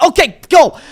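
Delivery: high, loud burst of mock panic; panicking. Player in a panic every time the ball comes to him, acted high and loud. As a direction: imitate a flustered player getting rid of it in a panic. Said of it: "like, panicking and".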